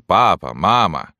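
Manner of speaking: mild imitation of a child calling; child's voice. A narrator's reading. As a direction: call out lightly as a child would. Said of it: "дерево кричит детским голосом". (слегка детский голос)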